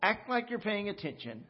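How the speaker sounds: normal talking voice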